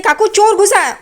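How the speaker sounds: high, acted alarm; urgent alert. Saili warns her aunt of a thief, acted urgent alert.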